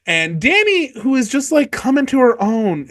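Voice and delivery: ordinary talk, nothing marked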